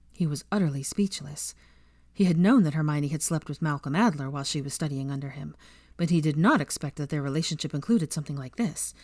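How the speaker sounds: ordinary delivery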